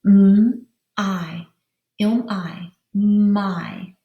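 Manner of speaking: "M-I" as separate sounds, then blended into "My"; a held hum for "M", then a long "I", blended to "My"; sounded out. Shown as a demonstration. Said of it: "Let's look at the first syllable".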